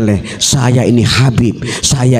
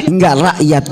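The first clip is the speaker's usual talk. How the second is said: normal talking voice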